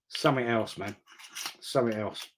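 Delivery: usual speaking voice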